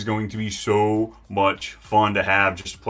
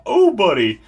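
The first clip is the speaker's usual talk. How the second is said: excited oh buddy; 10 semitones higher, 8 dB louder than usual. Real, not acted. After celebrating.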